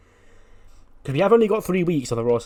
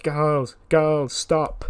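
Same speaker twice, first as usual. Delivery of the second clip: raised voice breaking up a quarrel; mild retold shout; 4 semitones higher, 5 dB louder, much slower than usual. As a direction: call out to halt an argument, half-shouting. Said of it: "starts shouting".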